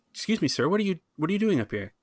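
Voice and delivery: high, fast startled guard; guard challenging. Acted. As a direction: catch the intruder off guard.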